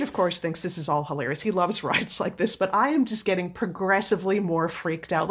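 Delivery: ordinary talk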